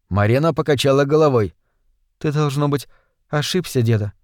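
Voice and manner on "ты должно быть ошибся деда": put-on soft young woman's voice; gently doubt a sick grandfather; hushed, careful contradiction; doubting granddaughter. A narrator's reading.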